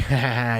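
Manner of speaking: low mock goofy laugh; imitated goofy laugh. Storyteller mimics the kid laughing at him, goofy laugh. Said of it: "in this goofy laugh".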